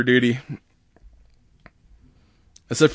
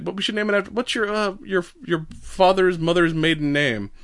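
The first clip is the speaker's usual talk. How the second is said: lightly voiced spy, higher pitch; Russian spy; 7 semitones higher than usual. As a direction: fish for secrets in a higher voice, lightly acted. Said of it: "Russian observer, who's definitely a spy".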